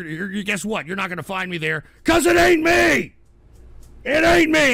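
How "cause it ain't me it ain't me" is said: loud, furious yell at the troll; angry shout. Real, not acted. Streamer to trolling chatter, angry shout. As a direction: blast it back at the chat.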